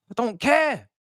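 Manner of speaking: high, frustrated nagging at a player; exasperated scolding. Real, not acted. Berating Enzo for weak play.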